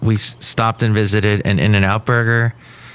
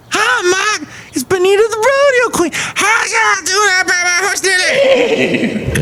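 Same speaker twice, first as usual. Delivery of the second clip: very high, shrieky cowgirl voice; hollering rodeo queen. Acted. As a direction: holler it in a piercing falsetto with a country twang. Plays Benita the Rodeo Queen.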